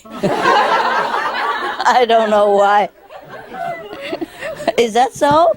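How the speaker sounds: talking through giggles; words broken by real laughter; laughing. Real, not acted. Laughing through his own joke.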